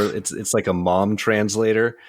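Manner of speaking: ordinary talk, nothing marked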